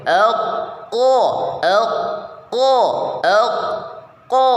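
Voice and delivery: ek dengan suara dikumpulkan di mulut; qaf tebal. Shown as a demonstration. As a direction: qaf berat dan tebal. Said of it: "suara menjadi tebal". (ek tebal)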